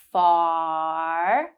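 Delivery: "Far" with a long drawn-out ah; held ah. Shown as a demonstration. Teacher drawing out the ah in "Far" for learners. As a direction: stretch the ah at the end of "Far". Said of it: "To practice the ah sound".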